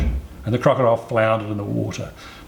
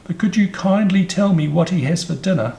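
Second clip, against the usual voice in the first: high, louder, eager question; curious elephant's child; 8 semitones higher, 5 dB louder than usual. A narrator's reading. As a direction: ask like an inquisitive little elephant. Curious elephant child asks the snake.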